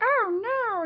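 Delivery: high, panicked mother; alarmed mom. Retold or imitated. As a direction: gasp it like a horrified mom. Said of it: "My mom was like".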